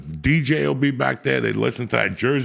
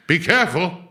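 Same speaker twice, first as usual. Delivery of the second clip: louder, higher overblown caution; mock loud warning; 3 semitones higher, 5 dB louder than usual. People handing him edibles, hammed-up loud warning. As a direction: ham up the warning like a worried parent. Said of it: "At least been there".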